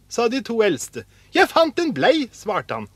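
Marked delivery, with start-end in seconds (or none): 1.3-2.3 high, loud, delighted answer; excited announcement. A narrator's reading. Askeladden tells his brothers of the wedge, excited. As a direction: proudly announce what you found.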